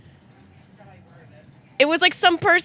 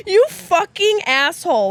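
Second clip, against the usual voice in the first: laughing; 10 semitones higher, 4 dB louder, slower than usual. Real, not acted. Laughing at friend's joke.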